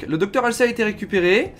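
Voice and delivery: ordinary talk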